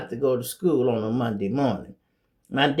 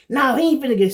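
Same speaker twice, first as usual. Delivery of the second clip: higher and louder, mom snapping no; sharp refusal; 10 semitones higher, 5 dB louder, much faster than usual. Retold or imitated. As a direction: shut it down hard. Mother refusing friend.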